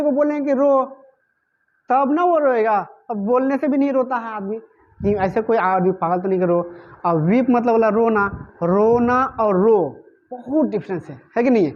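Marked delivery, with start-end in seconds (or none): none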